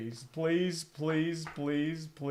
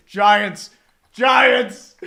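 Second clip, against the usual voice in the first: loud pleading cry of Giants; desperate shout; 2 semitones higher, 9 dB louder than usual. Real, not acted. Fan begging for Rodgers, shouting. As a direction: shout the team name in desperation.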